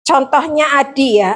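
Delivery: ordinary talk, nothing marked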